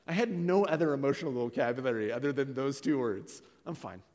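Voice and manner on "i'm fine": low, soft brush-off; closed-off denial. Retold or imitated. Husband answering the counselor's question, closed-off denial. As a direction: shut the question down quietly.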